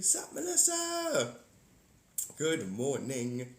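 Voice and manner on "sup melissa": drawn-out greeting. Acted. Greeting a chat viewer.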